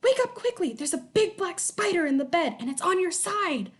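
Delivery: high, urgent warning; scary and urgent. A narrator's reading. Twin tricks sister awake, high scary urgency. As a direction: sister scaring twin about a spider, urgent. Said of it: "making her voice sound scary and urgent".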